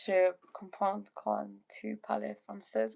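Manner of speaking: usual speaking voice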